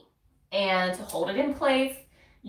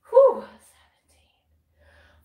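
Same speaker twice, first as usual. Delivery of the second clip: out of breath; 13 semitones higher, 11 dB louder, much slower than usual. Real, not acted. Exhausted mid-exercise breath.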